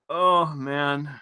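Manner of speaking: ordinary talk, nothing marked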